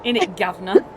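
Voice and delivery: a teasing, hammed-up Feywild accent on "Innit, governor"; mock Feywild accent. The game master teases the player's character with a mock Feywild accent. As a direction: lean into a jokey Feywild accent, rising at the end. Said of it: "his old Feywild accent slipping through".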